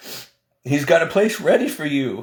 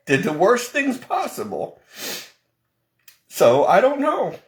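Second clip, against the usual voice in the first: holding back tears. Real, not acted. Testifying about God fighting for him, near tears. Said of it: "I'm trying not to cry".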